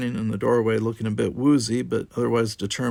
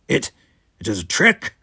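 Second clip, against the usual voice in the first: defensive snap; 6 dB louder than usual. Acted. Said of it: "snapped Gower defensively".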